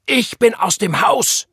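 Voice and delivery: slow, high, loud yell; angry shout. A narrator's reading. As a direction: shout each word in anger. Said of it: "schrie er". (wütend schreien)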